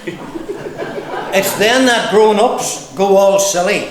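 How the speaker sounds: measured, rhythmic verse reading; comic poem recital. Acted. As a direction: recite it in lilting lines with pauses. Reads own poem to audience.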